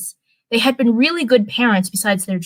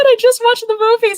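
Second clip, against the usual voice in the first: high, loud burst of disbelief; exclaimed; 13 semitones higher, 6 dB louder, faster than usual. Real, not acted. Reader exclaims at forgetting a movie she just watched. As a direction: blurt it out, pitch way up. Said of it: "so I'm already forgetting".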